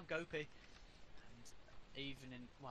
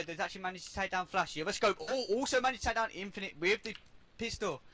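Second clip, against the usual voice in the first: rising, fast esports play-by-play; excited commentary; 6 semitones higher, 3 dB louder than usual. Real, not acted. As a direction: call the rush with building hype.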